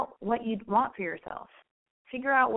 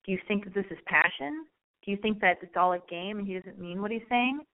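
usual speaking voice

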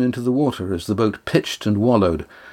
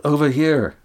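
higher call, falling at the end; 6 semitones higher than usual. A narrator's reading. Estrella calls Damon toward the boat, raised-pitch call. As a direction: call out to someone in the water. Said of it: "Estrella called".

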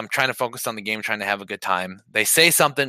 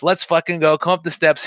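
raised, higher-pitched retold yell; worked-up retelling of a screamed challenge; retold screaming challenge; 6 semitones higher, 3 dB louder than usual. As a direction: call them out, reenacting a scream. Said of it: "I'm screaming. I'm like".

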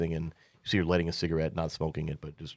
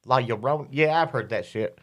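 dismissive woman; 6 semitones higher than usual. Acted. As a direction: brush it off as gossip. GM as Azul, dismissive.

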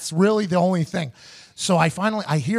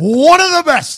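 proud boast; 9 semitones higher, 9 dB louder, slower than usual. Real, not acted. Repeats the boast.

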